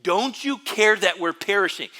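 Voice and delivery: loud, high desperate plea; dramatic cry. Retold or imitated. Preacher voicing disciples waking Jesus in the storm, loud and pleading. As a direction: wail it like you're going under. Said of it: "Very, very dramatic".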